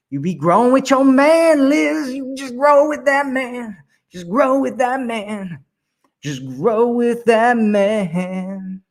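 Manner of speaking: high, drawn-out coaxing chant; playful high-pitched urging; high sing-song insistence. Acted. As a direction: sing it up high, slowing to a crawl at the end. Coaching Liz, high insistent chant.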